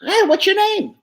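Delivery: quick bright dummy ask; dummy calling out to a lady; high dummy question. Shown as a demonstration. Dummy first asking a silent lady, high and quick. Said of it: "so what do you do?".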